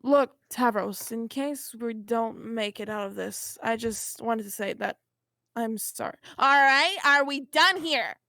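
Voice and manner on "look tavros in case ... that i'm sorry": low, soft, hesitant apology; sincere apology. Acted. Vriska apologising to Tavros before a likely capture, soft and sincere. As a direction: say sorry as if it might be the last time.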